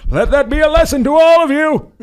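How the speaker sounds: high, loud proclamation from a balcony; mock yell. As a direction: bellow it down at the whole block. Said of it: "I yelled off the balcony".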